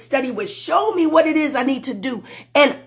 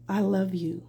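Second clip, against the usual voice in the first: tender; 5 semitones lower, 9 dB quieter than usual. Real, not acted. Sudden tender aside.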